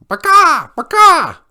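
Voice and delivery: high, loud squawk; screeching chicken. Acted. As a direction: screech it out like a panicked hen. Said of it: "this screeching from the chicken coop".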